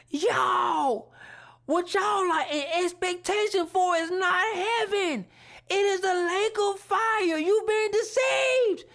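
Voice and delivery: urgent warning shout. Retold or imitated. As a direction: shout the warning across the gym. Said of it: "I screamed with everything".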